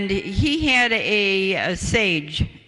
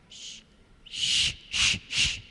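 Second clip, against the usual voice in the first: soft unvoiced shushing, a calming hush; 6 dB quieter than usual. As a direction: hush the grandfather gently, only breath.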